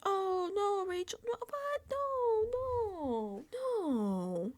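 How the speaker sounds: high, drawn-out fake wail; hammed-up whimpering no's, sliding down at the end; mock crocodile tears. Imitating Vince pleading with Rachel, mock tearful wail. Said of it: "he does his little crocodile".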